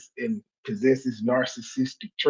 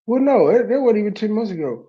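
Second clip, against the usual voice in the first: worked-up correction; 8 semitones higher, 3 dB louder, faster than usual. Real, not acted. Host correcting himself.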